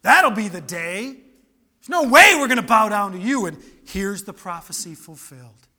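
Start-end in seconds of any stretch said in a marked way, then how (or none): 0.0-3.5 loud, sarcastic scoffing; mocking, emphatic dismissal, louder; scornful brothers. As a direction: jeer at the little brother's big dream. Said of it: "what was their response?".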